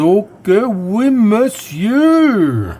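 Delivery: usual speaking voice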